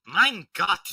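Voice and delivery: exasperated exclamation. Acted. Soldier character exclaiming in thought.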